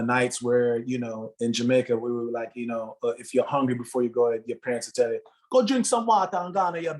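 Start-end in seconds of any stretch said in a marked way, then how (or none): 5.5-6.9 put-on high Jamaican mother's accent. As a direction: tell it like a Jamaican mum would. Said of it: "that Jamaican accent and tone".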